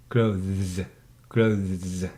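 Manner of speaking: "Clothes" with voiced th gliding into z; standard pronunciation. Shown as a demonstration. Teacher models standard form for pupil, "Clothes" with th-z. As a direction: buzz th then z at the end of "Clothes". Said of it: "pronounce the standard form".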